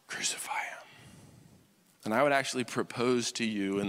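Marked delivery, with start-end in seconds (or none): 0.1-0.9 whispered crowd demand, softer. Retold or imitated. Pastor voices the crowd condemning Jesus, whispering. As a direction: hiss the crowd's verdict in a whisper. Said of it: "they're saying".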